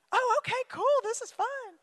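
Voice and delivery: high, light, easily persuaded; naive, cheerful joiner. Pretend. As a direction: sound happily clueless. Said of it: "bystander joining in".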